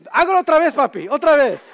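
put-on high, loud delighted little-kid shout; acted child's eager outburst; excited child's demand. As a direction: beg loudly for an encore like a thrilled child. Amazed child demanding the trick again.

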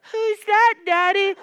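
loud, very high child mimicry; children's voices. Pastor's children asking about mother's friend, loud high kid voice. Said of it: "My children saying".

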